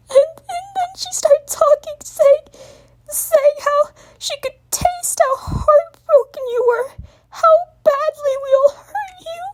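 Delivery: heartbroken crying; speaking through sobs. A narrator's reading. Young dragon sobs into Twilight's chest, retelling the taunts. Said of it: "speak through sobs".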